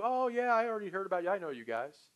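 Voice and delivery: high-pitched mock recognition; fibbing prospect. Prospect lying to escape the pitch, glib. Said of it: "So they lie to you".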